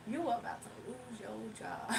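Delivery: hushed, airy repeat; playful whisper. Pretend. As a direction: whisper it softly, then break off.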